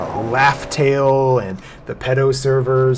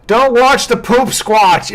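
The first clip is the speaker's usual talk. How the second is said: mock announcer voice; 9 semitones higher, 7 dB louder, slower than usual. Mocking nickname, drawn out.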